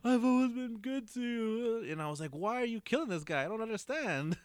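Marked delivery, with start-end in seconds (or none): 0.0-1.9 high, slow imitated plea; drawn-out, high pretend begging; mock begging. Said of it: "'Cause he was begging". 2.3-4.4 high-pitched acted bewilderment; high, put-on incomprehension; baffled protest. Acts out his baffled protest.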